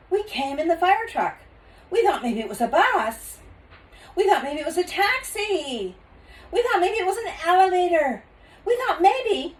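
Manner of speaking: high, loud, rushing explanation; excited child. Acted. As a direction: babble out the excuses.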